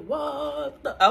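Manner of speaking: loud mock exclamation; mock outburst. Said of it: "I'm gonna be like".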